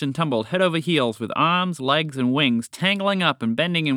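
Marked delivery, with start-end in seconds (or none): none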